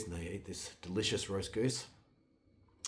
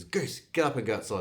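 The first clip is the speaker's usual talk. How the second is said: loud, higher-pitched order; raised-voice animal command; commanding the goose; 4 semitones higher, 9 dB louder than usual. A narrator's reading. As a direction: send the goose out with a ringing call.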